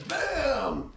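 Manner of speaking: a sudden high "bam" like a car hitting; crash sound. Retold or imitated. Instructor acting out the car hitting him, a high crash "bam". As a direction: punch out the crash noise. Crash in parking lot demo.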